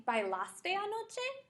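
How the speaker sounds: anoche with a high rising question tune; question model. Shown as a demonstration. Teacher demonstrates asking a friend about last night. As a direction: ask bailaste anoche with a lifted end. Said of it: "if I wanted to say".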